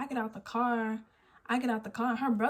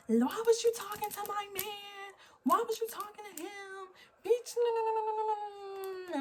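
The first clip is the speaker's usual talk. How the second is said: mock the jealous girl, pitched way up; imitating the girl; 11 semitones higher than usual. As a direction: parody her shrill-pitched accusation up high. Imitating the girl arguing from car.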